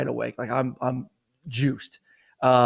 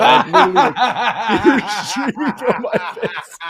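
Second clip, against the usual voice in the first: laugh-crying; 9 semitones higher, 7 dB louder than usual. Real, not acted. Telling the co-host about crying on air, laughing. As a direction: laugh helplessly while describing the tears. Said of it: "I start crying on the Zoom call".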